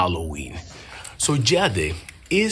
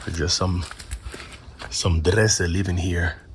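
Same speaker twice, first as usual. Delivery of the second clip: whispering; 4 semitones lower than usual. Real, not acted. Spooked in haunted building.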